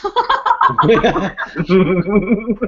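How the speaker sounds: high laughing talk; words bubbling with laughter. Real, not acted. Laughing at posting on Facebook.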